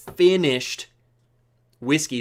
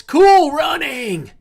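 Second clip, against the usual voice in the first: high, loud, drawn-out hail; excited shout; 12 semitones higher, 8 dB louder, much slower than usual. Real, not acted. Host hailing a viewer in chat, excited shout. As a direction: holler the name in a long, rising cheer. Calls out viewer's name in greeting.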